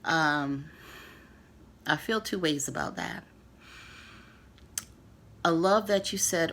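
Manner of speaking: ordinary delivery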